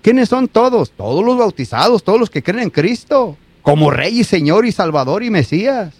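normal talking voice

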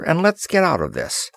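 ordinary delivery, nothing marked

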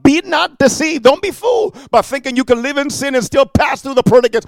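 preacher shouting a warning to sinners; preaching shout. Real, not acted. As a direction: thunder the warning at the crowd. Preacher warns the congregation.

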